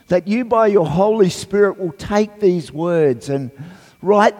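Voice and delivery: high, projected congregational prayer; public prayer. Real, not acted. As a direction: lead the church in earnest prayer, voice lifted.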